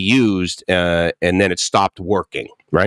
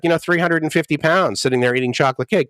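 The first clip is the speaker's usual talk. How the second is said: ordinary talk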